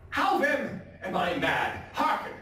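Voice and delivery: loud, challenging demand; defiant call. Acted. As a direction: command the listener to hear you out. Madman challenges the listener.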